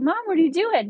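high, incredulous mom-what-are-you-doing; shocked disbelief. Retold or imitated. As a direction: pitch it up in stunned disbelief. Daughter shocked at mom's antics.

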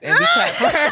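high squealing through laughter; laughing squeal. Real, not acted. Reading a listener's joke, squealing with real laughter.

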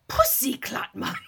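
loud mock Jamaican accent; imitating a Jamaican accent; Jamaican accent impression. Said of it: "he loves to impersonate this Jamaican accent".

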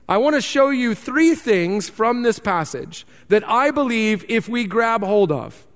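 normal talking voice

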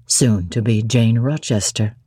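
deep, low, doting voice; Rochester, fond. A narrator's reading. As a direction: croon it low to his bride. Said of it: "he added".

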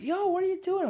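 high, loud call of surprise; mock startled shout from the sidewalk; surprised yell. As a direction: shout up at the window in shock. Date yelling from sidewalk.

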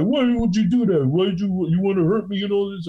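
mock whiny hurt guy; whiny victim. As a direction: play the wounded drunk. Guy whining after hit.

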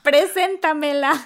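drawn-out excited plea; eager plea. Retold or imitated. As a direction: stretch it out like an excited fan. Friend begging to be introduced.

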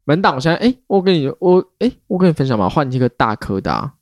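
ordinary delivery

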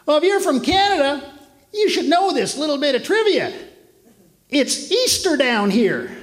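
a hint of a know-it-all Southern guy; Southern barfly voice. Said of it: "he goes".

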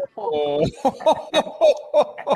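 a warm, genuine burst of laughter; real laugh. Real, not acted. Laughs at his own story.

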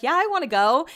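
lightly put-on enthusiasm; imagined eager inflection. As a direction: imitate her keen yes, lifting the pitch. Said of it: "doing the inflection as I ma-, imagine".

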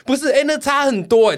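high, loud laughing question; laughing disbelief. Real, not acted. Laughing insistence, much higher, louder.

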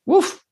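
a high, drawn-out sceptical "Uf". Acted. To listeners about the careless doctor, a high doubtful uf. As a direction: blow out a doubtful "Uf".

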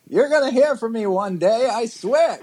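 high, defiant quote; defiant movie hero. Retold or imitated.

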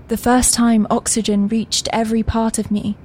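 ordinary delivery

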